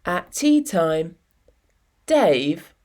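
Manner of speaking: plain reading voice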